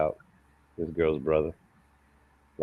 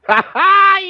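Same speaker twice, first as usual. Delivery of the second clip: loud laugh; 15 dB louder than usual. Real, not acted. Laughs at the nickname.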